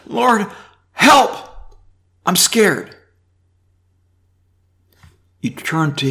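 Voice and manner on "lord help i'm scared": much higher, loud mock cry for help; a believer pleading scared to God, hammed-up; pretend desperate plea.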